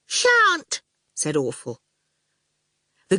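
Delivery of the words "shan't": put-on high, pert child voice; bossy little girl. A narrator's reading. Little sister orders the goon out, high and bossy. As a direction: boss him off in a high voice.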